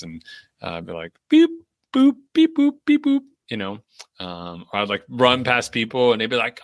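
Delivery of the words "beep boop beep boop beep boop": very high, loud beep-boop imitation; watch beeps. Runner imitating her watch beeping, very high and loud. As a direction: chirp the beeps like an electronic watch.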